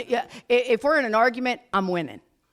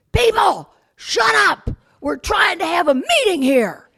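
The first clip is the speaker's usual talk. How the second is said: much higher, loud yell for silence; shout; 10 semitones higher, 6 dB louder than usual. Retold or imitated. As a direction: roar at four hundred people. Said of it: "he goes".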